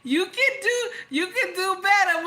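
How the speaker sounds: high, loud sassy mimic of a commenter; mocking Malik. Reading Malik's comment aloud, high sassy mock. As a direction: hype her up in a mocking voice. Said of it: "Malik said".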